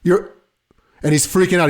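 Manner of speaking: normal talking voice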